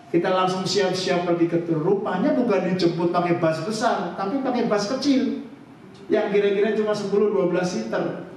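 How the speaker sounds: ordinary talk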